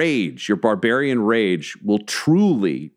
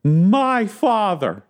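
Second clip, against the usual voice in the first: high, slow, furious yell; 3 dB louder, much slower than usual. Acted. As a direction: spit each word out at her father. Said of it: "as she yells".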